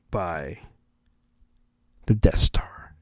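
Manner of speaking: usual speaking voice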